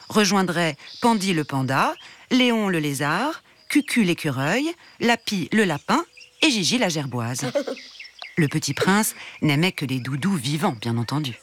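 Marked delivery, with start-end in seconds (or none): none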